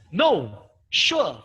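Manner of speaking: "No, sure" loud, each falling from top to bottom; exclamation tone. Shown as a demonstration. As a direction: shout "No, sure" with a sharp drop. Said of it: "that kind of exclamation tone".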